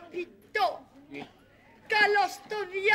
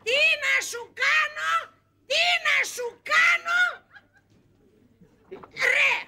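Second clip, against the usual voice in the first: exasperated giving in; 5 semitones higher, 4 dB louder than usual. Acted.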